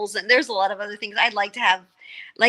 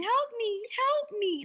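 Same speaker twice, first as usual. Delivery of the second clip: high peacock cry, kept softer; mock peacock scream; 13 semitones higher, 4 dB quieter, much slower than usual. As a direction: imitate the peacock's thin wail for help. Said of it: "he thought it was us screaming".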